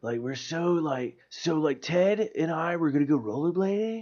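soft, drawn-out bro storytelling; mock spaced-out dude losing the thread; stoner dude. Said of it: "a dude version of that".

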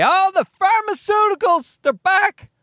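high, squealed mock dread; mock alarm. Podcaster greeting the pharmaceuticals as returning villains, high mock alarm.

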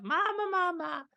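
put-on high babbling baby voice, mama over and over; imitating baby babble. Dad imitating his baby son's babbling. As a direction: babble like a nine-month-old. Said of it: "Just a long string of".

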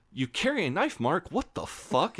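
a hint of a startled Sophie; shocked girlfriend. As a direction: girlfriend horrified he carries a knife.

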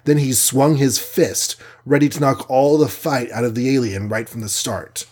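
ordinary delivery

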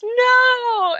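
put-on high, loud childlike no; son's protest. Mother imitating son protesting to dad, high and loud. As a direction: play the little boy refusing. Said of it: "And he goes".

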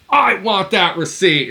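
loud, high mock demand; aggressive. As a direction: imitate a pushy, aggressive man. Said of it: "He got all aggressive".

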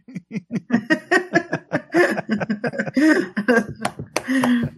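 long high cackle at his own punchline; high laugh. Real, not acted. Host cracking up at his own punchline, high laugh.

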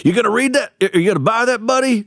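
high-pitched New York street vendor; New York accent. Retold or imitated. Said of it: "That was my best New York accent".

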